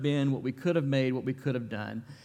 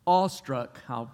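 normal talking voice

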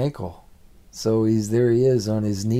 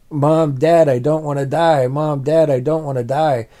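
higher, louder panicked cry; crying out for his parents in terror; retold scream; 4 semitones higher, 6 dB louder than usual. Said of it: "I'm screaming".

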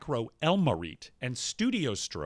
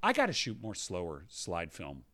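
low, soft, awestruck murmur; hushed awe; 8 semitones lower, 8 dB quieter than usual. Real, not acted. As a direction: drop low and quiet, savouring the wonder. Marvelling at his slide photos.

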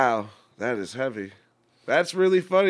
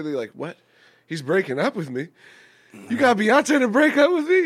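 high, loud mock outrage; hammed-up betrayed fan; shocked fan; 8 semitones higher, 5 dB louder than usual. Voicing dumped fan.

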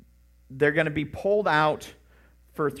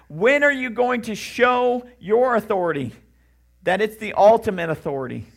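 a raised lament, begging God to act; anguished plea to God; 6 semitones higher, 9 dB louder than usual. Acted. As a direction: call out to God in desperate longing. Pleading prayer cry to God.

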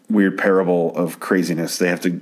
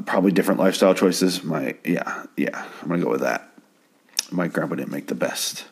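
ordinary delivery

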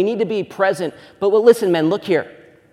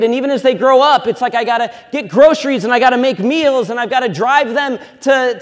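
higher, louder list of chores; frazzled busy mom; 6 semitones higher, 6 dB louder than usual. Retold or imitated. New mom listing endless chores, loud and frazzled. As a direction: rattle off the duties like an overwhelmed mom.

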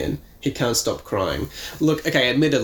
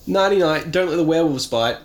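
teasing call; 6 semitones higher, 4 dB louder than usual. Acted. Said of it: "[name] laughs as she calls".

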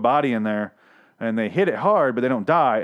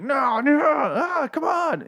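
much higher, loud mock shout of panic; panicked action-hero plea; 3 dB louder, much slower than usual. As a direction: ham up a desperate action hero begging for his life. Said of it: "Victor's action face when he's like".

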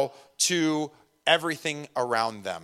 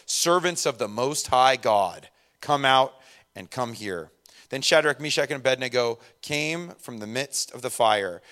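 measured Bible reading voice; scripture reading; 4 semitones lower than usual. Acted. Pastor reads Daniel to congregation, steady reading voice. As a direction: read the passage steadily to the church.

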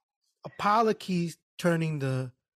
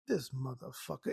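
muttered thought; 5 semitones lower, 11 dB quieter than usual. Retold or imitated. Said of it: "In my head, I was like".

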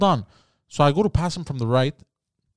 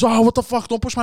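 higher, indignant shout; mock angry protest; 8 semitones higher, 4 dB louder than usual. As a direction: complain loudly about being pushed. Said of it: "he goes".

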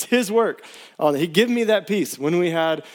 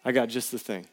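tender reassurance; 6 semitones lower, 8 dB quieter than usual. Pretend. Voicing God's reply again.

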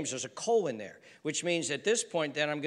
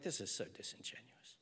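whispered aside; 9 semitones lower, 17 dB quieter than usual. Retold or imitated. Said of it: "And I'm like".

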